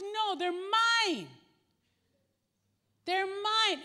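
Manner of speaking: high, loud, slow refusal; possessive child. Retold or imitated. Little boy yelling at his father that the fries are his, high and loud. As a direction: clutch the fries, yelling mine.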